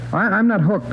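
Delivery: high, stammered protest; defensive denial. Acted. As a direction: protest nervously, pitch jumping up. Suspect denying addiction under questioning.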